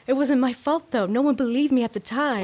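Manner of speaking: high, loud, dramatized self-defense; defensive protest. Retold or imitated. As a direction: plead innocence loudly, as the imitated janitor. Acts out janitor's defensive protest.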